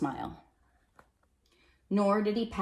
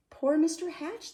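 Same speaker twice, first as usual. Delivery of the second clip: higher, sympathetic sigh of a phrase; pitying; 12 semitones higher than usual. Acted. Neighbours pitying Mister Hatch, higher and sympathetic. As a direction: say it with pity for a lonely friend. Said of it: "they said".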